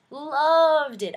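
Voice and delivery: loud, high repeat of loved; emphatic. Real, not acted. Vlogger repeating her verdict, loud and thrilled. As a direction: belt it out with delight.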